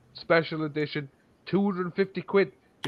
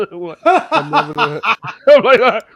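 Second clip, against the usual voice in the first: high, loud, cracking up mid-story; laughing; 8 semitones higher, 9 dB louder than usual. Real, not acted. Telling friends about the shop encounter, laughing loudly. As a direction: burst into laughter as you speak.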